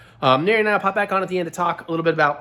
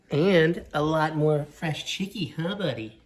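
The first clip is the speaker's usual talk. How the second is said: pet talk; 3 semitones higher, 4 dB quieter, slower than usual. Real, not acted. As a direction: coo at the kitty, slowly. Talking sweetly to the cat.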